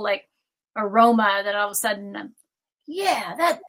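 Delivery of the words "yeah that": higher-pitched, eager acted yeah; rising agreement. Speaker acts out a thought taking hold, rising agreement. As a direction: play giving in to a creeping thought. Acting out a feeling creeping in.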